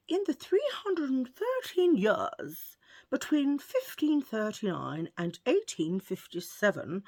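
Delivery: slow, high posh English accent. Shown as a demonstration. Vlogger reading a divorce fact to viewers in a posh English accent. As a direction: read it like a posh English lady. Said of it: "I'm gonna do this posh".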